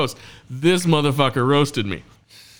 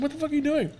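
high-pitched mock disbelief, a little softer; incredulous; 13 semitones higher, 6 dB quieter, much faster than usual. Host retells looking at the comic mid-set, incredulous. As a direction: question him in a high, disbelieving voice.

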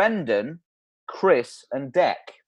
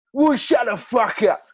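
put-on louder drunken snap; drunk Stu; 5 semitones higher, 4 dB louder than usual. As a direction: snarl it like an angry drunk.

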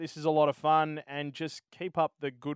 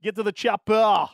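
Austrian accent attempt; 5 semitones higher, 9 dB louder than usual. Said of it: "He's Austrian".

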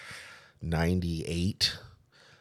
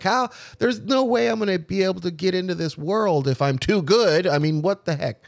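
retold upset lament; 11 semitones higher than usual. Crying down the block about never getting into tattooing, high and upset. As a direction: let it pour out, hurt and bewildered. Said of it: "So I was crying for a block".

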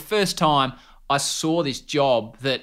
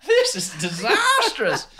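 much higher, slow, laughing; 12 semitones higher, slower than usual. Retold or imitated. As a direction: laugh at your own disaster. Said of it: "And I was like".

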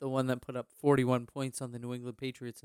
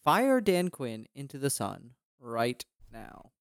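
ordinary talk, nothing marked

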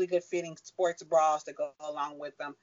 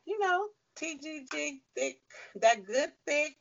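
imitating the friend, pitched up; friend's voice, higher; 10 semitones higher than usual. Retelling her friend explaining the name, high voice. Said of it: "she was like".

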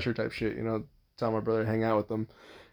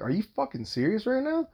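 high, rising retold question; incredulous; 10 semitones higher than usual. Father helping daughter up and looking at the stranger, incredulous. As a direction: pitch it up in indignant disbelief.

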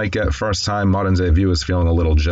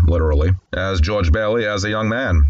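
ordinary delivery

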